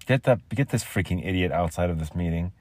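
usual speaking voice

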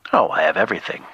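ordinary talk

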